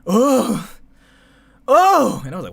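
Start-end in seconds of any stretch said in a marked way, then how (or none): 0.1-0.7 loud, high "oh" cry; reenacted scream. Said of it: "actually do a reenactment of". 1.7-2.2 very high, loud "oh" outburst; reenacted scream. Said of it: "it really sounded like that".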